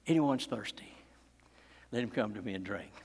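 low, soft and intimate; almost whispered to the congregation; preacher quietly repeating Jesus' invitation; hushed retelling. Acted. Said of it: "cried out".

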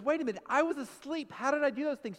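high, loud mock disbelief; acted-out indignant protest; protesting husband. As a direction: argue your innocence, baffled. Retold husband protests his innocence.

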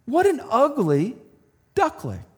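high, sneering little duckling; mean duckling. Acted. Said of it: "the other duckling said".

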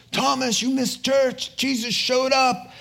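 high, eager news blurted out; excited disciples. Acted. Disciples excitedly tell Thomas he missed Jesus. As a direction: burst in with the great news.

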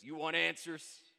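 loud, drawn-out defiant challenge; acted witness defiance; frustrated defiance. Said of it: "with frustration and defiance".

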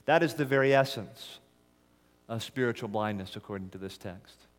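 usual speaking voice

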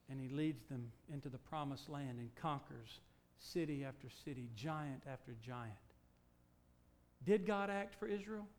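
ordinary talk

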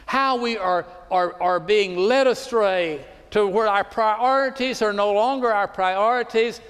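fervent pastor rallying his Houston church; full-force preaching. Acted. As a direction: preach it out over the congregation.

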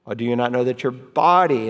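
"body" leaned on as the key word; stress on BODY. Shown as a demonstration. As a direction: make "body" stand out from the rest. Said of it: "you emphasize key words".

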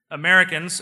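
loud radio hail; radio call. Acted. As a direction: hail them over the radio.

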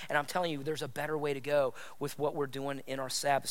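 soft, low-pitched pastoral urging; subdued, sincere preaching; hushed earnest appeal. Real, not acted. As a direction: keep it low and quiet, speaking to each listener. Earnest appeal after hushed invitation.

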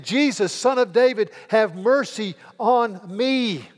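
crying out loud and high to Jesus; loud cry for mercy. A narrator's reading. Blind beggar crying out to Jesus, loud and pleading. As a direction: call out desperately from the roadside. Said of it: "he began to cry out in a loud voice".

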